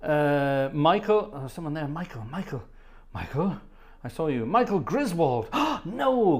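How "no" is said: mock gasp. Shocked at the name Griswold.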